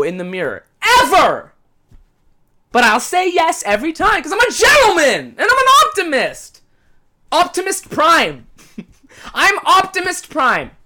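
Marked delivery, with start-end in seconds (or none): 1.0-1.5 emphatic. Real, not acted. Ranting about face masks. 2.7-10.7 high, loud, hammed-up boasting; mock heroic boast. Real, not acted.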